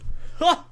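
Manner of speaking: high breathy burst of laughter; dragon laughing. Acted. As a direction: let out a big dragon laugh. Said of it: "Skardik laughed".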